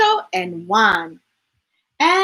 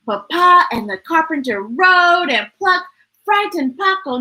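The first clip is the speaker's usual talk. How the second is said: ordinary delivery, nothing marked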